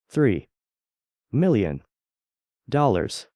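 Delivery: "Three", "million", "dollars" each set apart by a pause and hit in turn; slow, deliberate emphasis. A narrator's reading. Senator spells out the sum to the congresswoman, each word stressed apart. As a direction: lay each word down slowly on its own, one stress per word. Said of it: "he said the number slowly. Deliberately. Emphasis on each syllable".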